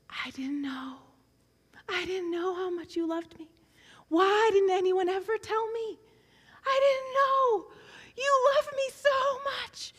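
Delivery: put-on a girl overwhelmed, from hushed tears to open crying; Emily weeping with eyes closed, whisper swelling to high slow crying; tearful whisper building to a cry. Emily weeping on her bunk.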